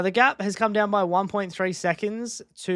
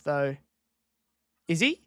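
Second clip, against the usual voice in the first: ordinary talk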